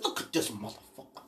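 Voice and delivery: a low disgusted aside; muttered aside. Retold or imitated. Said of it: "I said".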